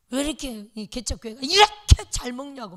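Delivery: loud, indignant complaint; angry scolding. Retold or imitated. Deaconess complaining the church eats too well, loud and indignant. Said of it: "막 역정을".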